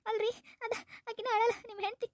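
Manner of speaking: high, crying tattle; acted weepy, high-pitched voice; tearful accusation. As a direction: sob out a complaint for sympathy. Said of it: "ಎದಕ್ ಅಳಾಕತ್ತಿ?".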